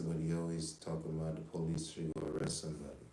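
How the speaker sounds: normal talking voice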